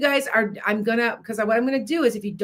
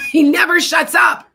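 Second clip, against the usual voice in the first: louder and higher, laughing through it; laughing exasperated shout; 9 semitones higher, 10 dB louder than usual. Real, not acted. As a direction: burst out laughing and yell it. Laughing repeat about the barking dog.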